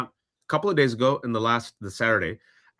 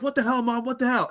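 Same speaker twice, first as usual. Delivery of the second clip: much higher, outraged what the hell; shocked kids; 11 semitones higher than usual. Retold or imitated. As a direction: squawk it like stunned teenagers. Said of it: "the kids are like".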